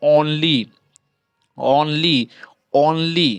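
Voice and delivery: only repeated as separate ऑन-ली, with pauses; O sound demonstrated. Shown as a demonstration. Teacher showing learners the O in only, deliberate repetition. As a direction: model the opening O of only, pausing between repeats. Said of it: "ओ का उच्चारण हो रहा है".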